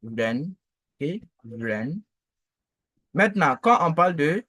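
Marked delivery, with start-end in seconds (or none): 0.0-0.5 "vren" gliding upward; rising model "vren"; second tone. Shown as a demonstration. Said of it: "le deuxième ton". 1.4-2.0 "vren" gliding upward; rising model "vren"; second tone. Shown as a demonstration. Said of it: "le deuxième ton".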